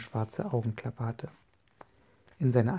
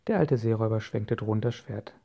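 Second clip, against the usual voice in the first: ordinary delivery, nothing marked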